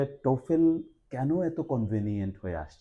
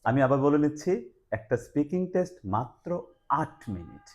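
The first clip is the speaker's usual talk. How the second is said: higher and louder, landing on "আট minute"; emphatic restatement; 6 semitones higher, 5 dB louder than usual. Real, not acted. Host restating the test length for viewers, emphatic restatement. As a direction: drive home "মাত্র আট minute" with raised pitch.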